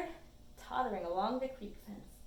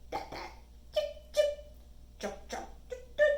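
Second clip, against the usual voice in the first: short, sharp mixed bird chirps; staccato chirps and peeps; mockingbird mimicry. A narrator's reading. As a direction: copy small birdcalls, two of each. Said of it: "She copies all of the songs".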